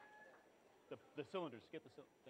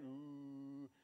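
low ooh; 5 semitones lower, 7 dB quieter than usual. Shown as a demonstration. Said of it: "If I wanna make a real low frequency".

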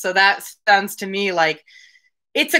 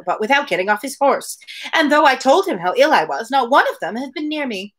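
Mary's lines read a little higher, in a lightly voiced complaining tone; dialogue read aloud; 3 semitones higher than usual. Podcaster reading Mary's complaints to Anne, lightly voiced.

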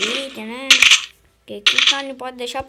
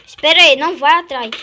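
loud warning to a teammate; urgent shout; 11 dB louder than usual. Real, not acted. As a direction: shout him back, urgently. Stopping teammate from chasing.

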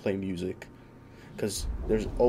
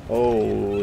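usual speaking voice

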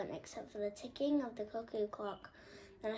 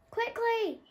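high, louder urging; urgent; 13 semitones higher, 8 dB louder than usual. A narrator's reading. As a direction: hurry them along. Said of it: "said Muriel".

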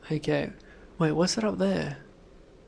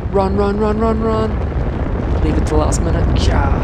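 loud, high, urgent run calls; frantic yelling; 3 semitones higher, 8 dB louder than usual. Real, not acted. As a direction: yell in a panic. Frantic escape.

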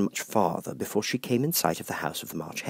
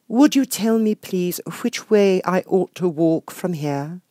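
put-on polite young Alice; 11 semitones higher, 4 dB louder than usual. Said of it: "Alice asks the cat".

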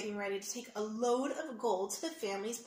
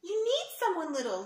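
higher, louder insistent cry; eager cry; 7 semitones higher, 6 dB louder than usual. Acted. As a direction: plead to be picked. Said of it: "Liam cried".

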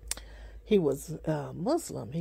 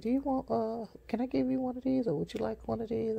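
high friendly offer; 7 semitones higher than usual. Retold or imitated. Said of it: "I was like".

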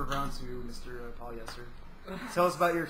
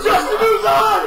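laughing shout; 13 semitones higher, 12 dB louder than usual. Real, not acted. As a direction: announce it laughing. Announcing the winner.

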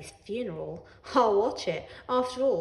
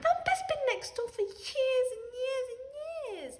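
much higher, loud distraught wail; Ellie wailing; 3 dB louder than usual. A narrator's reading. Ellie wailing over dead Thumper, high broken cry. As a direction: break down over the dead rabbit. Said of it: "she cried".

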